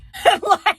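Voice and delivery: a sudden bright cackle; loud laugh. Real, not acted. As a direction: burst of loud, high laughter. Laughing at own joke.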